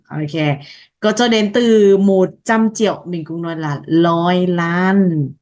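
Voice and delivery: ordinary talk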